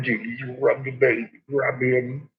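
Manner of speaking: usual speaking voice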